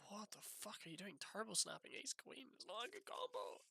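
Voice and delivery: high, soft, incredulous questioning; quiet, baffled complaint; hushed disbelief. Real, not acted. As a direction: protest under your breath, pitched high. Muttering disbelief at opponent's call.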